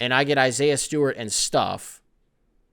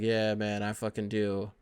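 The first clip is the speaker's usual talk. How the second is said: mock gloomy Jimmy Butler; 5 semitones lower, 5 dB quieter than usual. Jimmy Butler answering Ben Simmons, mock gloomy and low. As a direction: lower and softer, a mock glum sigh of a reply. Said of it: "Jimmy Butler's gonna be like".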